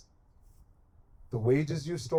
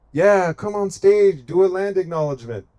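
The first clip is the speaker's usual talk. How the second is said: mock invitation; 4 semitones higher, 7 dB louder than usual. Voicing officials inviting Native people on stage, mocking. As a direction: call it out higher and louder, mockingly.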